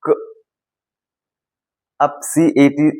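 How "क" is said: क as a short "kuh"; short "kuh", not "see", for क; phonic sound of C. Shown as a demonstration. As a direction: say क as the bare hard C sound. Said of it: "सी का sound होगा".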